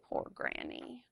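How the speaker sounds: soft unvoiced "Poor Granny"; pitying whisper. A narrator's reading. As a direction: whisper it with sympathy. Sympathetic aside, whispered.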